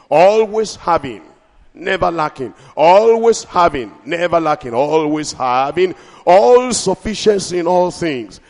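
chant-like alternation of always and never; rhythmic preaching. Acted. Rhythmic call.

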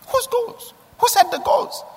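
Whose goals? asked high and pointed, Whose stressed; high emphatic question. Shown as a demonstration. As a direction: pitch it way up, probing. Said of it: "repeated question".

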